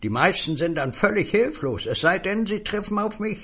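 a high, eager little stranger selling words; high, wheedling salesman voice. Acted. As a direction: pitch it up like a pushy little peddler.

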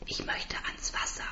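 tonloses Flüstern; geflüstert. Acted. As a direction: hauche den Wunsch. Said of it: "sage ich". (flüsternd)

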